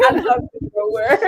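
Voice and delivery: real amused laugh riding on the line. Real, not acted. Guest playing along with teasing about big neuro words, laughing.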